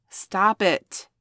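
loud scolding; firm stop. Acted. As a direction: push him off with your voice. Pushing away the rough puppy.

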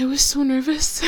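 loud, tearful outpouring of relief; happy crying. Acted. Telling the new fiance about the nerves, happy crying. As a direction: let relief spill out through tears. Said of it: "Sorry, I'm crying".

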